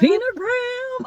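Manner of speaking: high, drawn-out squeal of a name; excited. Real, not acted. Telling host she met her idol, excited squeal. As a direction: squeal it like a thrilled fan. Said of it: "I'm so excited".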